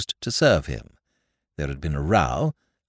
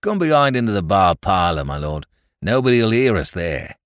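ordinary talk

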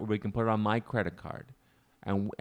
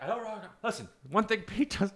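higher, pleading; pleading job coach; 10 semitones higher than usual. Retold or imitated. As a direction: beg him, pitched high. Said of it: "And he's like".

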